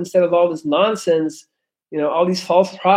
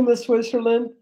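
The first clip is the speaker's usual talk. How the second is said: normal talking voice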